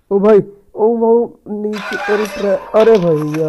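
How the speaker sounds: loud, high, stretched shout downstairs; calling out. Real, not acted. Gamer yelling down the stairs at Granny to come. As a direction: yell down to get her attention.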